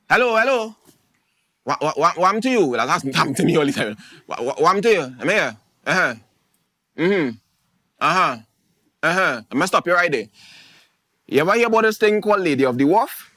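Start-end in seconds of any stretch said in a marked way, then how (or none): 0.1-2.7 nosy bush-medicine auntie. Retold or imitated. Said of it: "somebody might just see and be like". 4.3-13.3 nosy bush-medicine auntie. Retold or imitated. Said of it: "somebody might just see and be like".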